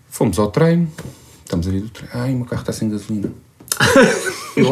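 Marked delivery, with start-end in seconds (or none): 2.1-3.3 soft, complaining sigh; whiny wife voice. Retold or imitated. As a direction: moan it like a tired wife.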